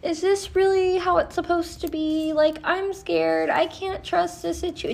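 high, mock-worried overthinker; mock anxious voice. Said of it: "because you're like".

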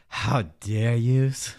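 slow, drawn-out mock of a pretentious exasperated plea. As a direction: ham up a theatrical, exasperated How dare yous, very slow. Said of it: "her pretentious, exasperated".

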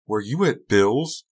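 cross mother interrogating; angry question. A narrator's reading. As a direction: demand it angrily, drawn out. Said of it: "Missus Norwood asked angrily".

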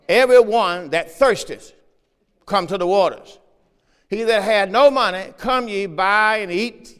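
loud, emphatic pulpit reading, phrase by phrase; scripture proclamation. Real, not acted. Preacher reads Isaiah's invitation to the congregation, proclaiming it loudly. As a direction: declaim the verse to the whole congregation.

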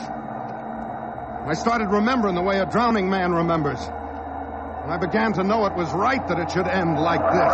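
high, strained and fatalistic; desperate narration. Acted. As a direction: narrate like facing death. Narration nearing death crash.

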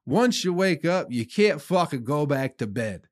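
ordinary talk, nothing marked

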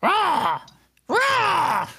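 shrill crow caws; raspy cawing crow. Acted.

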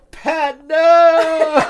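loud, high mock cry of alarm. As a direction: imitate a brother yelling after someone. Brother cries out in story.

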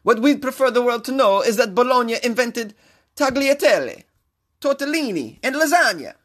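put-on high-pitched, singsong Italian accent; hammed-up Italian mayor impression; sexy Italian accent. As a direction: relish each pasta name like a comic Italian. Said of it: "with this sexy-ass Italian accent".

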